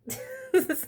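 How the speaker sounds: real delighted laugh; loud high laugh. Real, not acted. Laughing at the comeback.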